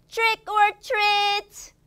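put-on high, playful kid's door call; trick-or-treat call. Teacher voicing a child at a neighbour's door, playful call. Kids' Halloween door greeting.

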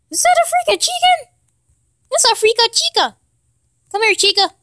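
high, thrilled burst at a find; excited squeal. Real, not acted. Gamer spotting a chicken, thrilled. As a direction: leap up in pitch with delight.

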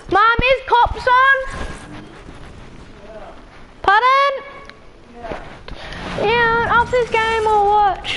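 high-pitched shout across the house; calling out to mom. Real, not acted. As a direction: yell the question up high.

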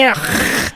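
throaty, voiceless "ugh" imitating his accent; exaggerated throat-clearing imitation of the accent; mock choking noise. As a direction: mimic a choked guttural rasp. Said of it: "You sound as if you're choking".

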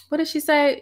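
normal talking voice

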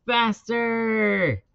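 high, drawn-out yell; stretched coaching holler; coach's shout. A narrator's reading. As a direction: shout the order down the track. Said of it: "shouted his coach".

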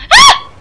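sudden high, loud shriek; startled scream. Real, not acted. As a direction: scream in real fright. Animal jumps at raft.

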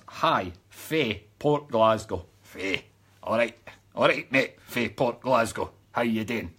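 put-on low-pitched Port Glasgow accent; mock Port Glasgow greeting accent. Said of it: "had to do it in that accent".

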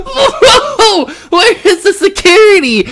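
high squeal through laughter; cracking up while talking; laughing. Real, not acted. Streamer laughing at game scene.

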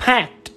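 packed ending in a released t; final t sound. Shown as a demonstration. Teacher showing the ending of packed, final t sound. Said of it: "âm đuôi đọc là tờ".

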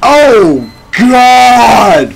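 high, loud, drawn-out cry; stretched shout, startled by the game; alarmed shout. Real, not acted. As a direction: yell out in dismay. Shock during game.

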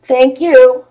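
high, cooing thank-you; touched thanks. Real, not acted. Thanking a kind viewer.

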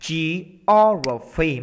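very slow "Geography" with a heavy O; "Geography", second syllable held long; stress on second syllable. Shown as a demonstration. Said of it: "mình sẽ nhấn vào chỗ này này".

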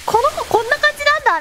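host mother's surprise. Retold or imitated. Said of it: "みたいな".

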